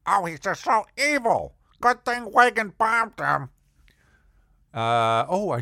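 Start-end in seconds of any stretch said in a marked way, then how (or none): 0.1-3.5 put-on high, loud old landlady, indignant; hammed-up old lady denouncing a villain; Mama Jerry. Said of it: "she would refer to him".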